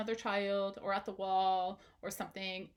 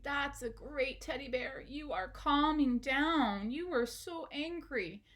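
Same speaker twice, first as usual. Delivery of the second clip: higher, slow soothing praise; praising the teddy; 4 semitones higher, slower than usual. Pretend. Parent praising the teddy bear as it calms, slow and soothing. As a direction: coax the bear into calm. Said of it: "And you're like".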